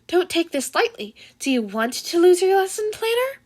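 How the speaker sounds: a stern small creature telling him off; Nezu voice. Acted.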